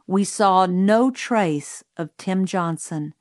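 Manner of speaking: plain reading voice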